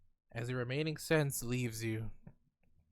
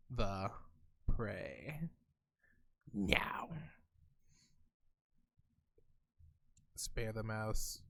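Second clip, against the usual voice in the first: low, slow, soft threat; menacing cat; 5 semitones lower, 7 dB quieter, much slower than usual. Acted. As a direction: loom over them in a low menacing voice.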